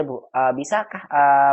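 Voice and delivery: ordinary delivery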